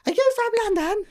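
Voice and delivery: high put-on London accent. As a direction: mimic someone faking a London accent. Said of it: "they try and do the London accent after".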